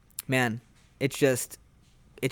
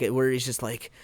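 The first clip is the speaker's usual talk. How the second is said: usual speaking voice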